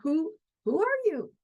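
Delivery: curious wonder. Shown as a demonstration. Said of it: "Isn't that a great question?".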